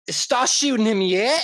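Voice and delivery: high, bright mock British accent. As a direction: put on a cheeky British gun voice. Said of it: "in the British accent".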